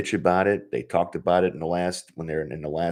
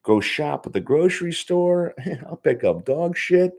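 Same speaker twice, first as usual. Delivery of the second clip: higher, hyped-up mock pestering of the boss's son; mock excited rant; 10 semitones higher than usual. Joking to listeners about pestering Bill, hyped-up and higher. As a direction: ham up an eager fan begging for inside news. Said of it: "So I'm like".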